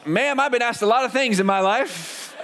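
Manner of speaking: lightly voiced amused big man, slow; a hint of a deadpan football player; big friend answers the lady with a laugh; dry big guy. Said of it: "He goes".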